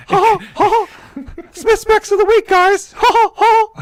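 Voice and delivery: very high squeaky cartoon mouse; Mickey Mouse voice. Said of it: "You wanted me to do the Mickey Mouse?".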